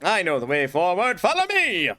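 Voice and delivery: rally the troops, hammed up; mock heroic call. Player leading viewers forward as a guide, mock heroic cry.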